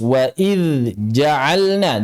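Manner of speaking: "idz" drawn out, not merged into "ja'alna"; very slow "wa idz ja'alna", dz fully sounded; clear, unmerged dhal. Shown as a demonstration. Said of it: "jangan diidghamkan".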